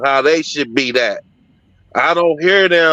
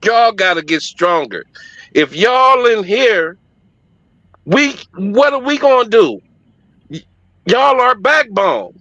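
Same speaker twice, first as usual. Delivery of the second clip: imitating pleading church women; 5 semitones higher than usual. Women on stage urging shelter men to be stronger, raised plea. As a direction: urge the men to be stronger, voice climbing. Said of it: "Them women was up on that stage like".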